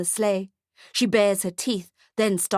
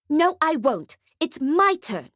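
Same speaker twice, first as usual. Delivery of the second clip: put-on higher, stubborn child; bratty girl voice; 8 semitones higher than usual. A narrator's reading. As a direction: insist like a brat.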